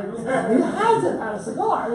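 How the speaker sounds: laughing through the words. Real, not acted. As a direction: say it again, chuckling at the image. Repeats the line with a laugh.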